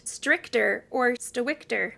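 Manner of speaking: "stricter or stowicter", Sister Whitaker squashed into one word; words run together. A narrator's reading. Narrator shows how Mary says Sister Whitaker, words run together. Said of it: "she ran the two words together".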